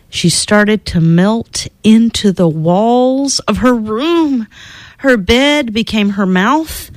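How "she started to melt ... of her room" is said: usual speaking voice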